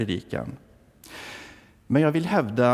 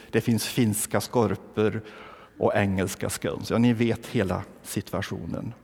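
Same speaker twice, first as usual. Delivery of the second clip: usual speaking voice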